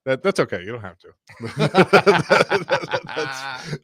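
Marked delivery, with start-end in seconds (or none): 1.4-3.1 loud high laugh; burst of laughter. Real, not acted. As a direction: crack up out loud.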